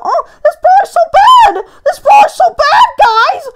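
mock your own rage at a bad brawler; raging shout. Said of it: "And I go".

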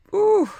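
a high, loud "ooh" yelp of outrage; sudden shriek. Real, not acted. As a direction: burst out with a shrill ooh. Sudden outburst at neighbor.

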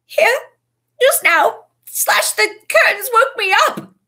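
high, loud, frightened blurting; panicked. A narrator's reading. Ron reports the attack.

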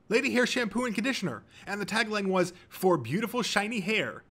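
high, excited fanboy answer; eager contestant. A narrator's reading. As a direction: reel off the trivia gleefully.

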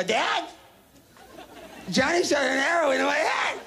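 put-on high kid voice; a small boy reporting to his father; little boy telling dad. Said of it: "And I go".

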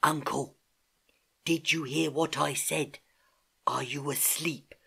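slow, hushed, close to the ear; whispered. A narrator's reading. Niece checking if uncle is asleep, whispering. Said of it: "whispered in his ear".

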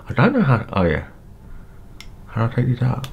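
normal talking voice

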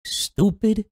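normal talking voice

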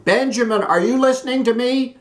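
high, loud insistent call. A narrator's reading. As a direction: call him out, getting impatient. Calling husband who ignores her.

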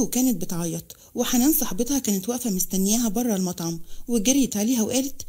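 usual speaking voice

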